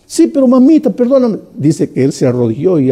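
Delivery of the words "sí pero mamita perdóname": put-on higher, pleading little boy's voice; a son pleading with his mother not to be punished, high and begging; child pleading. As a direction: beg mamita like a frightened child.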